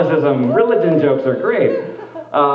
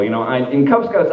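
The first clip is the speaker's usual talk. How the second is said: ordinary delivery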